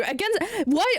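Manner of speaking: usual speaking voice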